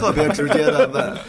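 laughing. Real, not acted. Still laughing.

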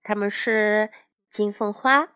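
ordinary delivery, nothing marked

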